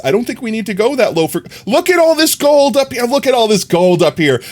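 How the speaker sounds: excited discovery. Real, not acted. Excitedly spotting gold.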